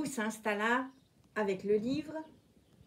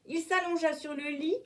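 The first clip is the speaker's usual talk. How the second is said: plain reading voice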